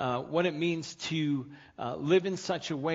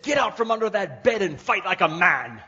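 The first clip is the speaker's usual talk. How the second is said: loud, high mock yell breaking into laughter; laughing punchline shout from the wife; wife's yell; 7 semitones higher, 11 dB louder than usual. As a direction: bellow the wife's order as the punchline. Wife yells at husband under bed.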